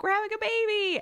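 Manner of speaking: imitating a high, emotional squeal; mock tearful announcement. Telling husband about the baby, re-enacted as a high tearful squeal. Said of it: "And I was crying".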